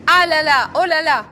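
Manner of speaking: loud, high oh là là at the pothole; shocked exclamation. Real, not acted. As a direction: cry out in alarm at the hole. Sees a huge pothole.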